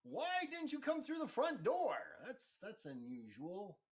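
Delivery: high laughing NPC greeting the intruder; amused NPC host. Acted. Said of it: "He looks down and goes".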